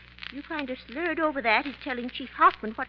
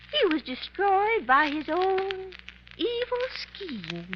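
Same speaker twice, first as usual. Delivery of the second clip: slow and solemn; 4 semitones higher, slower than usual. Acted. Solemn judgment on the villain.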